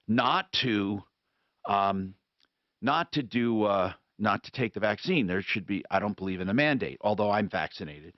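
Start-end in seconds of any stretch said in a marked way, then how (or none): none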